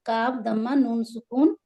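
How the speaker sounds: normal talking voice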